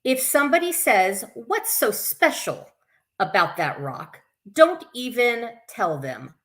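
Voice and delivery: put-on children's story reading. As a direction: read it out to little ones, bright and sing-song. Reads picture book to kids.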